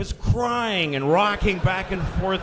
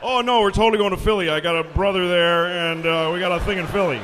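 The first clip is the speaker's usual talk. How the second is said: quick, louder passenger fib; breezy cover story; 3 semitones lower, 5 dB louder, faster than usual. Acted. Passenger lying about going to Philly, breezy and quick. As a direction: rattle it off as a casual excuse.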